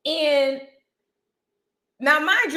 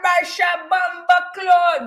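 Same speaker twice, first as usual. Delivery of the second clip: mock Jamaican hollering; 5 semitones higher, slower than usual. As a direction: holler it in a put-on Jamaican accent like a tourist. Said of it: "on the back of the horse hollering".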